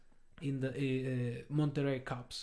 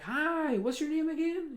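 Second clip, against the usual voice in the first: imitating a much higher-pitched welcoming official; friendly official; 14 semitones higher, slower than usual. Police head greeting his visitor, friendly official. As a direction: greet in a high, chirpy mock-polite voice.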